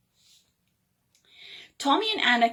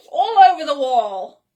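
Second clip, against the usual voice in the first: plain reading voice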